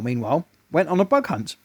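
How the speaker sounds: usual speaking voice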